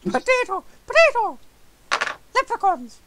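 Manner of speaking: high, loud cartoon Irish; mock-Irish squeal. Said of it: "don't forget potato".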